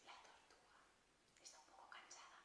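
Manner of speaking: whisper. Acted. Introducing sleepy tortoise.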